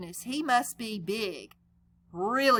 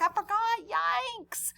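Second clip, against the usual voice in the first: put-on high kid voice; 10 semitones higher, slower than usual. Clark and Joey voiced high.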